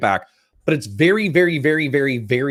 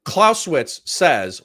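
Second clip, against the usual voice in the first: ordinary talk, nothing marked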